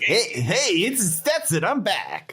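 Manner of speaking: high-pitched albatross familiar; high put-on critter voice; cool red voice. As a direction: pitch it way up, a cartoon bird announcing his return. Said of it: "it's almost like your cool red voice".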